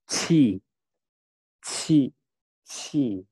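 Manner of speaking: "ci" blown out with a burst of air; aspirated q. Shown as a demonstration. Teacher lets learners feel the air, aspirated "ci". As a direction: puff out each "ci", falling. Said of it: "Jadi dihembuskan udara".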